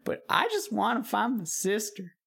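put-on weary, pleading old witch. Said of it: "And the witch says".

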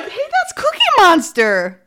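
excited. Retold or imitated. Host reacting to the scene.

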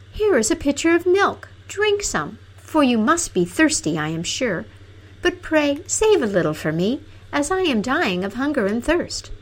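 high, pleading princess voice; sweet princess begging to share; cartoon princess. Princess offers the milk.

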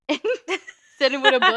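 loud, laugh-filled telling. Real, not acted. Podcaster mocking their high school reading pose, laughing. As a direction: crack up while confessing.

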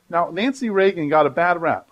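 ordinary talk, nothing marked

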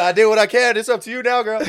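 high, loud mock dog voice; voicing the dog. Friend acting out the movie dog leaving, mock loud. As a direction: play the dog bailing out. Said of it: "He's like".

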